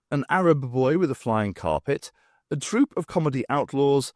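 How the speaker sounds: plain reading voice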